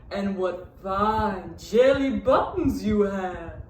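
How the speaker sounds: slow, drawn-out cow voice; mooing cow. A narrator's reading. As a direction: stretch each word like a lazy cow. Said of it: "mooed the cow".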